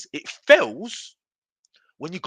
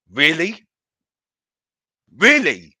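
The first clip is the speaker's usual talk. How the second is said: incredulous; 9 dB louder than usual. Real, not acted. Incredulous at the narrative.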